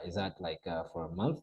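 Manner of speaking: low, soft, halting self-quote; hesitant question. Retold or imitated. Newcomer asking about ticket price, quietly hesitant. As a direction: ask it tentatively, voice sunk down.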